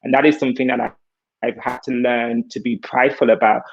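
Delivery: usual speaking voice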